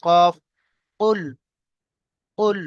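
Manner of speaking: throaty, echoing q in "কফ" and "কুল"; qaf with qalqalah. Shown as a demonstration. Teacher showing learners the letter qaf, bouncing throaty q in "কুল". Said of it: "এই কফ উচ্চারণ করার সময় একটু কলকলা সহিত".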